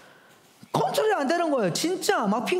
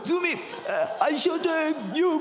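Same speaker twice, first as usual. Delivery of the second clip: a hint of a small daughter trying not to cry; pitiful little girl; 3 semitones higher than usual. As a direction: plead like a tearful little girl holding back sobs. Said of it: "막 이러면서".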